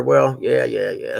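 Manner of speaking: usual speaking voice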